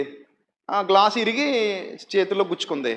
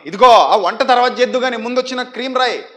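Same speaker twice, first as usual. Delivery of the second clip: louder call to the wife; 4 semitones higher, 6 dB louder than usual. Retold or imitated. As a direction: call her over, impatient. Husband calling wife.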